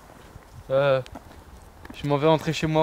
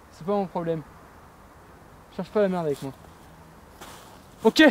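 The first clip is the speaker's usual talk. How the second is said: ordinary talk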